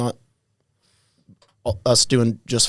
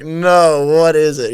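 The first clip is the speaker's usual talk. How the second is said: startled disbelief; 5 semitones higher, 9 dB louder, much slower than usual. Retold or imitated. Reacting to broken collarbone news.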